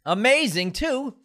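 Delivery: high, loud burst of praise; excited. Real, not acted. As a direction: gush over it.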